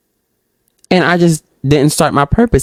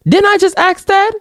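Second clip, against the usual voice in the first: high-pitched squeak of disbelief; incredulous reaction. Real, not acted. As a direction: pitch it way up, baffled by the repeat. Reacts to a repeated question.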